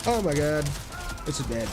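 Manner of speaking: usual speaking voice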